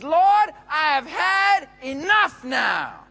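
slow, loud, high despairing cry; fed-up outcry. Acted. Elijah crying out to God that he's had enough, loud and slow. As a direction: shout it out, completely worn down. Exhausted prophet cries out.

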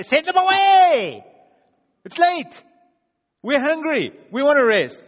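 high, loud, slow mock-whining crowd of disciples; whiny disciples. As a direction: ham up the grumbling disciples begging to quit. Disciples voiced complaining to Jesus.

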